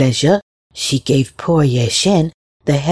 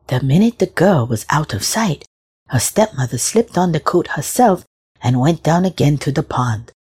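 ordinary delivery, nothing marked

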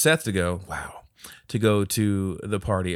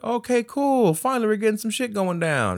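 much higher, eager exclamation; mock excitement; 14 semitones higher, 3 dB louder than usual. Telling co-host how viewers react, much higher eager exclaim. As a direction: squeal with relief that the plot moves.

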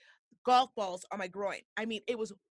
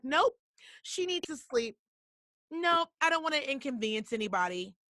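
stubborn refusal; 5 semitones higher than usual. Retold or imitated. Stubborn self refusing help.

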